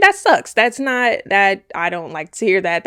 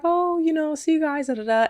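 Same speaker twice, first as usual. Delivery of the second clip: wife's goodbyes; 8 semitones higher than usual. Retold or imitated. Voices wife saying goodbyes.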